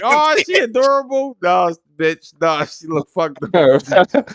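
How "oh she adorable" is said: high, mock-gushing fake compliment; fake sweet gushing. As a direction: gush like a fake-polite relative. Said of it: "you be like".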